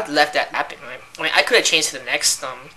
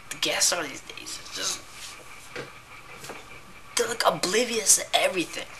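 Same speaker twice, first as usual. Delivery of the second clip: a breathy complaint under the breath; whispered aside; 3 semitones higher, 9 dB quieter than usual. Real, not acted. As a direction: whisper it, unvoiced and secretive. Whispered complaint about guests.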